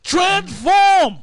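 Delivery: re-enacted wizard's command; imitated magic command. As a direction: call it out like a spell, imitating the film.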